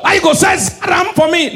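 high, loud mock angry yell; loud high mock complaint; angry shout. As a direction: shout at him in mock outrage. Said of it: "Igbo call the prophet".

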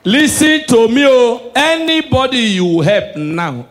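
high, slow, stressed plea; slow emphatic appeal. Acted. Comedian urging the audience, slow emphatic. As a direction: lean into each word. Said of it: "emphatic appeal".

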